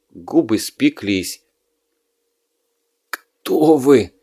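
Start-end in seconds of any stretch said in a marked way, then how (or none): 3.1-4.1 hoarse wheeze of a wounded man; wounded soldier gasping. Acted. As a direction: rasp it out, barely alive. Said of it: "опять прохрипел раненый".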